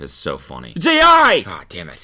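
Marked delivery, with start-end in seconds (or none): 0.8-1.4 high, loud shout; sudden high yell; mock alarm call-out. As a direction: blurt it out at full volume.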